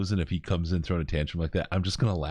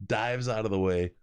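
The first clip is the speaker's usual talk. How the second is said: ordinary delivery, nothing marked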